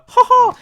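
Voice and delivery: loud, punched-out "ha ha"; exaggerated two-beat fake laugh; mock laugh. Podcaster reacts to zebra crossing crash, mock laughing.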